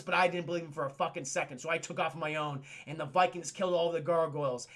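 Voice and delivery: ordinary delivery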